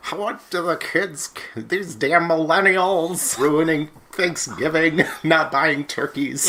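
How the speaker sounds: put-on high, slow grumpy old man. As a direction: grumble like a cranky grandpa. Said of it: "I sound like I'm ninety-seven years old".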